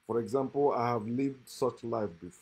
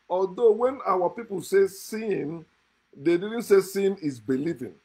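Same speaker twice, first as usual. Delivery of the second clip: raised, higher-pitched fervent preaching; louder, higher preacher's fervor; impassioned preaching; 6 semitones higher, 5 dB louder than usual. Real, not acted. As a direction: drive the point home at full force, pitch up. Impassioned anti-religion sermon.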